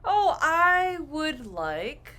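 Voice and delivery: slow, drawn-out "I would like"; slow full form. Shown as a demonstration. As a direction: stretch out every word of "I would like". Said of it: "you could say".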